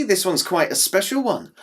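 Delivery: ordinary delivery, nothing marked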